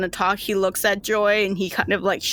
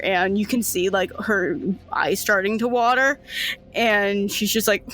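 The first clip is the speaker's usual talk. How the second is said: ordinary talk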